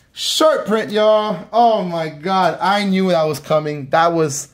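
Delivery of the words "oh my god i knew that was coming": ordinary delivery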